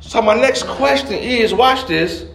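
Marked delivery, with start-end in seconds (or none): none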